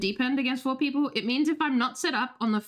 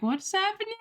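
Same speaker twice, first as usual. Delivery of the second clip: baffled; 8 semitones higher than usual. Retold or imitated. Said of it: "I'm like".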